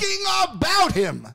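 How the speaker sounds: high, slow, drawn-out disbelief; incredulous storyteller's climax; astonished emphasis. Real, not acted. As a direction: stretch each word high in amazement.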